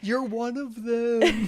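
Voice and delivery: high, loud, drawn-out fake terror; slow wail of pretend panic; mock terrified. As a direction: play a hammed-up frightened hostage. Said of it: "being totally terrified and pretending to be that guy".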